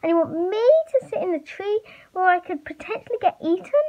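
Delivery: high, fast, worried protest; worried shout. A narrator's reading. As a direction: raise the pitch and rush it, worried. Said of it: "Sammy shouted, worried".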